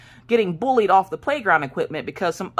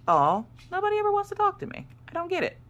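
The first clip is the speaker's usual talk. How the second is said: high whiny mock pout, much higher; mock self-pity; 14 semitones higher than usual. To viewers after the dad shut the door, a high mock whine. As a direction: pretend sulk, pitched way up. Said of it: "I'm like".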